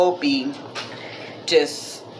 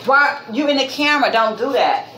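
higher-pitched parental telling-off; scolding; 7 semitones higher than usual. Real, not acted. Parent scolds child in camera shot.

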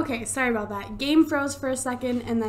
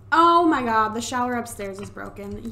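ordinary talk, nothing marked

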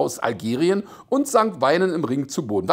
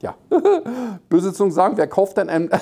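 normal talking voice

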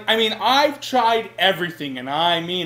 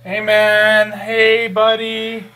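coaxing call; 4 semitones higher, 6 dB louder, slower than usual. Acted. Timidly calling an angry friend.

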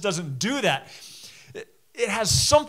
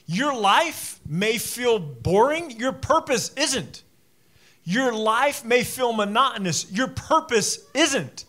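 emphatic, higher-pitched sermon delivery; preaching; 6 semitones higher, 5 dB louder, slower than usual. Real, not acted. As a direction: preach it with drawn-out key words.

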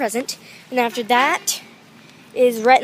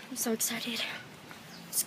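very soft, whispered excitement; excited whisper; 18 dB quieter than usual. Real, not acted. Vlogger confiding excitement to viewers, whispering. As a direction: whisper it, barely voiced. Hushed aside to camera after a woo.

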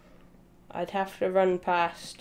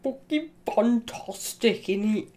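mock British accent; 2 semitones higher than usual. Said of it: "Being British".

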